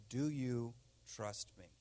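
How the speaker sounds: low, soft divine question; God. Retold or imitated. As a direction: put the question gently and very quietly. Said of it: "He says".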